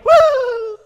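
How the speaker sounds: high, loud mocking "woo"; loud jeering whoop; taunting whoop. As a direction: let out a long, high whoop to taunt them. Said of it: "this giant just kinda goes".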